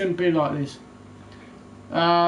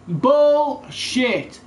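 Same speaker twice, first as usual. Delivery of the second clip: one loud, high scornful word; real angry snap; angry outburst; 6 semitones higher, 3 dB louder than usual. Real, not acted. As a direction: spit it out in disgust. Ranting about player pace.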